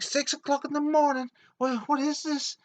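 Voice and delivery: very high, loud indignant squawk; outraged boss. Retold or imitated. Boss woken at six by his security man, high outraged yell. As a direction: shriek in mock outrage.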